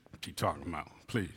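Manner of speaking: normal talking voice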